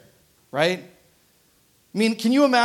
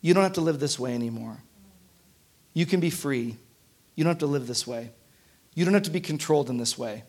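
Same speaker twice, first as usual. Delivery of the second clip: much lower and softer, tender reassurance; God's gentle reassurance; 7 semitones lower, 8 dB quieter, faster than usual. Retold or imitated. God telling the congregation gently they can be free. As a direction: lay it down softly, like setting them free. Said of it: "what God is saying to all of us is".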